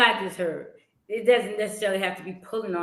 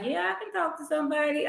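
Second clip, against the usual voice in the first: tearful; 8 semitones higher, 4 dB quieter, faster than usual. Retold or imitated. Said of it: "I'm like".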